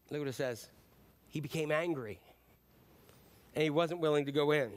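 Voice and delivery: ordinary talk, nothing marked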